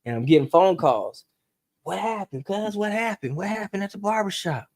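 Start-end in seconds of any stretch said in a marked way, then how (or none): 1.8-4.7 urgent caller. Retold or imitated. Caller voiced on the phone.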